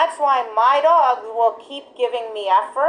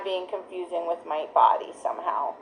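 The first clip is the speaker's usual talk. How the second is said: breathy whispered self-question; 5 semitones lower, 8 dB quieter than usual. Real, not acted. Trainer asking herself quietly about her body cues, whispered. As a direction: drop to a whisper as if asking yourself. Self-check aside to viewers.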